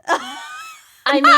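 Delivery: bursts out laughing mid-word; real laughing outburst. Real, not acted. Laughing at the recording jab.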